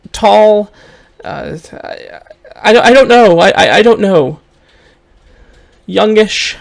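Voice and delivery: high, loud, flustered stammer; distraught mother. Acted. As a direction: get agitated as you fail to remember. Game master plays Honey, distraught mother.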